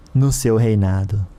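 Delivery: usual speaking voice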